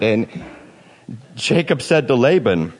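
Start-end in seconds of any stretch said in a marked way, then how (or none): none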